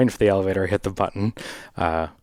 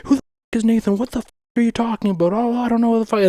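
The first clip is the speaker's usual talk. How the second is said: much higher, louder rant with a strange voice; mock furious yell; 4 dB louder, faster than usual. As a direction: blow up at a wrong-number caller, hammed up. Stranger swearing on buzzer.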